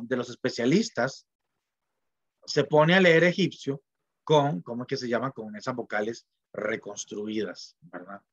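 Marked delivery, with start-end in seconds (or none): none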